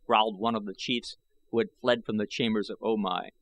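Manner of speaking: ordinary delivery, nothing marked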